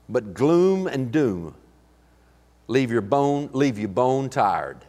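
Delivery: slow, heavy doom-laden phrasing; mournful, slowed verse read; gloomy slow reading. Acted. Message paraphrase read gloomily.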